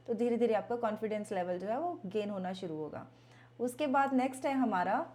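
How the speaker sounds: ordinary delivery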